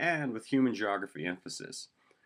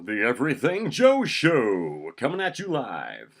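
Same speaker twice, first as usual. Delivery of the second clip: slow, loud, high show hype; announcer voice; 6 semitones higher, 9 dB louder, slower than usual. Acted. Host promotes his live web show, announcer voice. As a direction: belt out the title in drawn-out announcer style. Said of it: "crack out the announcer voice".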